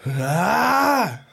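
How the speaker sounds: long, loud rising scream; high playful wail; mock freak-out scream. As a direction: scream as if losing it, high and drawn out. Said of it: "about to tweak".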